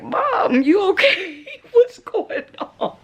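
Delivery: high, laughing imitation of a concerned son; son's worried voice. Son finding mom on the floor, mimicked with laughs. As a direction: play the kid checking on mom, chuckling. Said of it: "He was like".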